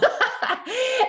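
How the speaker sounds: a sudden, high, loud laugh; high burst of laughter. Real, not acted. Laughing at the woman's scam joke, a high loud laugh.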